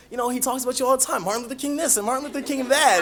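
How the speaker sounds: quick, high-pitched gushing; excited grandmother. Retold or imitated. Grandmother telling teacher what the boy talks about, excited. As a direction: imitate a thrilled grandmother rattling on. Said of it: "She's, like, so excited".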